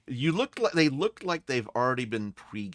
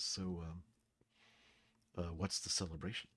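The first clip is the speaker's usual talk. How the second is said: soft, lowered confidential question; leaning-in low voice; 3 semitones lower, 10 dB quieter than usual. Acted. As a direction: ask it softly, as if leaning across the bar. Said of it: "he kinda leans over to you and says".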